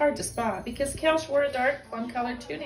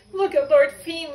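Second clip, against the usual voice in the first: higher and louder, bursting to point it out; excited cry; 6 semitones higher, 10 dB louder than usual. Acted. Lila calling to Celie in excitement, higher and louder. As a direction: call out in glee. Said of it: "Lila cried".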